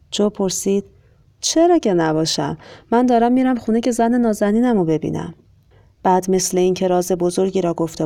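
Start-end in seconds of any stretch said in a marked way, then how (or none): 1.4-5.3 higher-pitched happy reply; cheerful. A narrator's reading. Joe tells the conductor he is going home to his wife, cheerfully. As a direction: answer brightly, bursting with good news.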